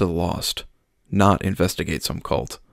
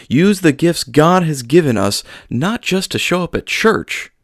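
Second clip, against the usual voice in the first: raised, ringing pulpit voice; sermon preaching; 4 semitones higher, 5 dB louder than usual. Acted. Pastor warns the sparse congregation, preaching loudly. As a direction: preach it out to the pews.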